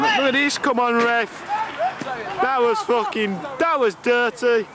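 indignant appeal to the ref; pleading with the referee. Real, not acted. Complaining to the referee.

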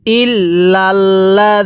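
ইল্লাল্লাযীনা intoned very slowly with held double l; teacher modelling ইল্লাল্লাযীনা in tajweed; slow Quranic recitation. Shown as a demonstration. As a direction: chant ইল্লাল্লাযীনা slowly, stretching the vowels. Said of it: "আরেকবার পড়বেন".

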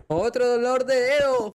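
drawn-out, high, loud wail; exasperated cry. Real, not acted. Player groaning at another setback, exasperated cry.

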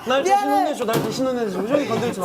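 high, loud theatrical plea; hammed-up protective begging; mock pleading. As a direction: plead dramatically to take the blame. Mock pleading to shield bandmate.

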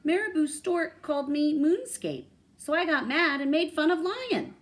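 high, loud animated character voice; lively animal character, high and loud; storybook vulture confessing. A narrator's reading.

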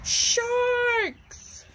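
high, drawn-out spooky word; dramatic reveal. Acted. Reading to children, spooky high reveal. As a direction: land the big reveal high and slow.

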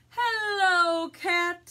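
drawn-out loud duck hello; calling out a greeting. Acted. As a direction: hail a friend high and loud as the duck. Duck calls to Cat in story.

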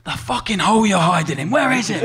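imitating an angry gaffer getting angrier. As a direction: play the manager blowing his top. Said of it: "getting angry and angry".

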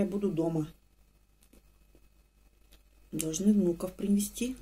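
speaking between bites; talking while chewing. Real, not acted. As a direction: mouth full of rusk. Seen 0: Bites dunked rusk, then talks while chewing with full mouth.

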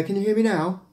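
normal talking voice

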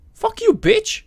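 very high furious shout; angry yell. Acted. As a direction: scream it in outrage. Rejected, yelling.

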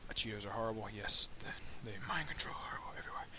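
hushed conspiratorial whisper; whispered aside. Pretend. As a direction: lean in and whisper like a secret.

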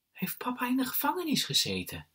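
put-on very high child voice; astonished little brother. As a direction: ask wide-eyed as a small boy.

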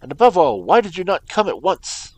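loud, high, raving at him; exasperated cry. A narrator's reading. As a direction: rage at the delay. Holmes continues raving.

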